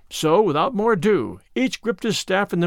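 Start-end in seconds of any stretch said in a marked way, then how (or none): none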